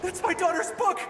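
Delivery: high, pleading and desperate; a father clutching at hope; desperate cry. Acted. Desperate father finds daughter's trail.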